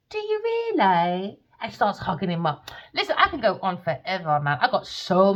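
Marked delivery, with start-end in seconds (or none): 0.1-1.4 high, slow, sing-song mock girly voice; mocking her voice. Mimicking how she asked him, high and slow. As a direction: drag it out in a sugary high voice. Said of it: "And the way she said".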